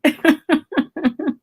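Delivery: very high, loud, fast squeal of laughter; real hysterical laughing. Real, not acted. Speaker echoes listener's salad joke, shrieking with laughter. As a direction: shriek the question through helpless giggles.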